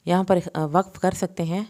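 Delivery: ordinary talk, nothing marked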